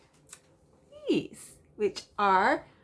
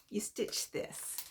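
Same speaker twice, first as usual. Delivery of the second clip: amused whisper; whispered wonder at a QR code pattern; 11 dB quieter, slower than usual. Real, not acted. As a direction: breathe it out in disbelief. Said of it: "That's what you stitch".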